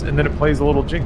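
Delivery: ordinary talk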